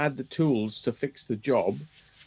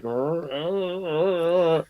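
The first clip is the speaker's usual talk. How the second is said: mock paranoid growl; 4 semitones higher, slower than usual. Driver imagining the trip, growling rrr nervously. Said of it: "I'm gonna be like".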